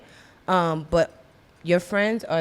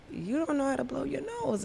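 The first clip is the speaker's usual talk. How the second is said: higher, softer imitation of an aunt; Lali; 8 semitones higher, 11 dB quieter than usual. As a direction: lightly mimic a chiding aunt, high and soft. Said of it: "Lali was like".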